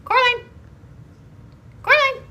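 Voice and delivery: high, loud call. Real, not acted. Calling her cat Coraline from afar. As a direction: call the cat across the room.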